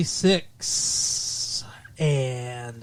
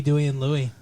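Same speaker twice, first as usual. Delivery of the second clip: ordinary talk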